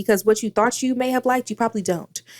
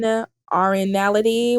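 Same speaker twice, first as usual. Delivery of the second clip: normal talking voice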